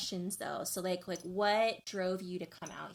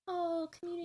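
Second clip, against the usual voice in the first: mock wonder; 11 semitones higher than usual. Mocking strangers bonding over curry.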